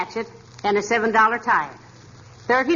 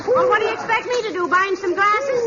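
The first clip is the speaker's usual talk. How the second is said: higher, flustered shooing of the owl; flustered wife shooing the bird; excited shooing; 10 semitones higher than usual. Acted. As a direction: wave it off in a fluster.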